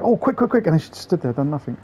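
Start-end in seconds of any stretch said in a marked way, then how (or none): 0.0-0.7 much higher, mocking hurry; hammed-up panicky partner; mock hurried partner. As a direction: squeak it like a fussing partner. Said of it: "She ran to that spot like".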